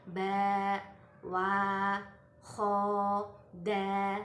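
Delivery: each syllable held out long and loud; long alif vowels. Shown as a demonstration. Tajwid teacher showing alif after fathah, drawn-out syllables. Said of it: "yaitu seperti".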